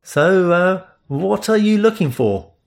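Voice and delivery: guarded, lightly voiced stranger. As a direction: hold back, wary of the stranger. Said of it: "His voice was guarded".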